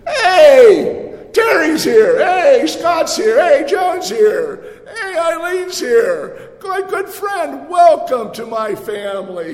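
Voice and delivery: high, loud, delighted welcome shouts; joyful calling out. Pretend. Jesus welcomes each friend by name, shouting with joy. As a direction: announce each friend with open arms. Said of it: "He says".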